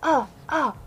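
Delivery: high, hammed-up fake moans; mock moaning. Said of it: "Who are always moaning".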